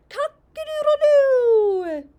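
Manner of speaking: loud, high crow with a long last note; rooster crow. Acted. Reader shows a child what roosters say, full loud crow. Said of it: "You say it with me".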